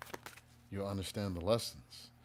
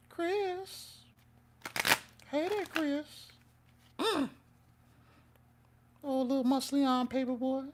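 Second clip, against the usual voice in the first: put-on very high, quavering old pervert voice; a thin, high old-man croon, nothing like his own; Herbert impression. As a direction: creep it out like a leering old man. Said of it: "I'm gonna do a voice for y'all".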